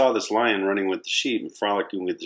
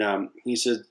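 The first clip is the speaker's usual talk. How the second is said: usual speaking voice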